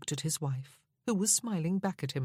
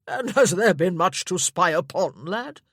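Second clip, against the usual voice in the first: loud, booming jolly uncle with a laugh; hearty old lord; 3 semitones higher, 10 dB louder than usual. Acted. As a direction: boom it out like a hungry, merry old lord.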